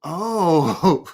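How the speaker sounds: long drawn-out "oh" as it clicks; slow, imitated "oh" of understanding; dawning realization. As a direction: retell the penny dropping. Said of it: "He got it".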